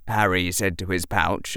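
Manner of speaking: plain reading voice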